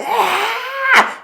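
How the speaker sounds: a quick pained "ah" of frustration; mock frustrated cry. Shown as a demonstration. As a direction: demo of a frustrated shout, high and held back. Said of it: "shout of frustration".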